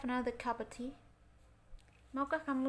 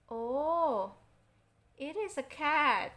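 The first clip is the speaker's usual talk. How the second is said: higher, louder, slightly slow surprised discovery; 4 semitones higher, 6 dB louder, much slower than usual. Acted. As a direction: sound surprised it's only a cat. Finding a cat at door. (terkejut ternyata cuma kucing)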